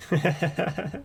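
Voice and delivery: short burst of real laughter. Real, not acted. Reader cracking up mid-reading of the transcript, laughing. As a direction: break out laughing briefly. Breaks up after mistaken interruption.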